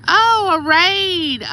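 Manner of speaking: high, loud burst of happy surprise; delighted surprise. Real, not acted. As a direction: exclaim it high as unexpected guests pour in. Raid arrives during live stream.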